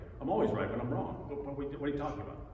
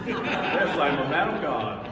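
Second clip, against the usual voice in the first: laughing through the answer, louder and higher; laughing yes; 4 semitones higher, 7 dB louder, slower than usual. Retold or imitated. Replying to the homeless man who asked, laughing.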